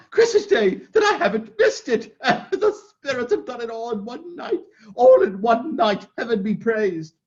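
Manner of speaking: high laughing, near crying; joy that cracks into sobs; laughing through tears. Acted. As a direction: laugh and weep with relief.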